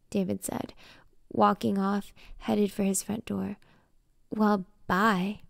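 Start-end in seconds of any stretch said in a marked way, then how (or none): 4.3-5.4 pissed off. A narrator's reading. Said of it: "I said, pissed".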